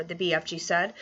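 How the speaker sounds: ordinary delivery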